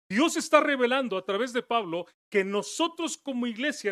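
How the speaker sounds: loud, building preaching; impassioned sermon; fervent preaching. Acted. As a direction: preach it up to the climax. Preaching climax.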